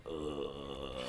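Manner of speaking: low, soft, drawn-out "ugh"; bored groan. Acted. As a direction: groan quietly like a bored couch viewer. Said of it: "I'd be like".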